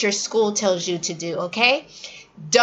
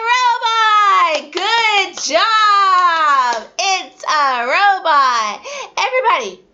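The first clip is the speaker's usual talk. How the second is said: very high, louder, gushing with a laugh; excited praise; 8 semitones higher, 4 dB louder, much slower than usual. Shown as a demonstration. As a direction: praise the kid big. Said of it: "praising child".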